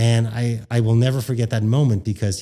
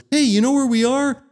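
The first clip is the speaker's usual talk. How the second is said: a hint of Brian announcing it, pitched higher; mock stage call to the crowd, pitched up; imitating Brian; 11 semitones higher than usual. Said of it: "Brian goes".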